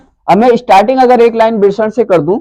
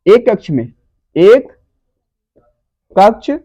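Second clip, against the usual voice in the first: word by word, pausing after each; dictation; slower than usual. Shown as a demonstration. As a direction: dictate piece by piece for note-takers. Teacher dictates next sentence. (लिखवाते हुए, ठहर-ठहरकर)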